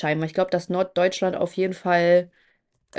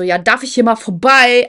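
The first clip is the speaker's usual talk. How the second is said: loud, higher, rude demand to get past; antisocial; 4 semitones higher, 8 dB louder than usual. Retold or imitated. Rude girl at the escalator demanding to pass, antisocial. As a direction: imitate a rude girl shoving through. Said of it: "Also so richtig asozial".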